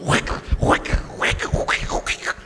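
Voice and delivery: unvoiced whick whick of a mousse can; whispered spray noise; spray sound. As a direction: imitate a hair mousse pump.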